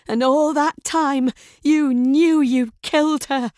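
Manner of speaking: high, loud, voice running away from her; crescendoing voice. A narrator's reading. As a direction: hurl the accusation, losing control. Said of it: "Grace's voice has crescendoed".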